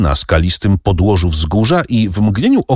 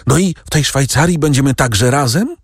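breathless call; 7 semitones higher than usual. A narrator's reading. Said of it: "wołał zdyszany".

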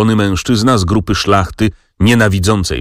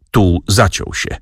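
ordinary delivery, nothing marked

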